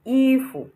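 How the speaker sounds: "ifu" with loud high i, short fu; neutral tone. Shown as a demonstration. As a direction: hold i, cut fu off. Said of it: "there is no tone on the U".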